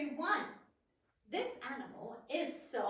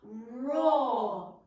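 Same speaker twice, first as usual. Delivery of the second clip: roaring lion; 4 semitones lower, 8 dB louder than usual. Shown as a demonstration. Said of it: "Say the word roar together".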